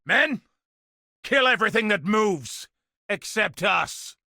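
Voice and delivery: high shouted order to attack; battle command. Acted. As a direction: bellow it over the fighting.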